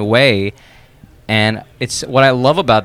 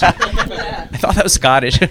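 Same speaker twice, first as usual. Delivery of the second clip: laughing; 6 semitones higher, slower than usual. Real, not acted. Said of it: "Great sound effect".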